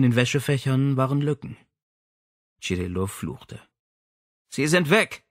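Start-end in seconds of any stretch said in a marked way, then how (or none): 4.5-5.2 calling out. Acted. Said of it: "rief sie".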